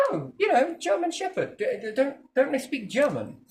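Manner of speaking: put-on high-pitched child's explanation; daughter's voice. Daughter explains German Shepherd to dad, imitated high and halting. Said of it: "She said something really funny to me today".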